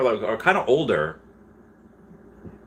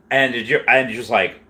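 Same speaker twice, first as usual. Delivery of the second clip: normal talking voice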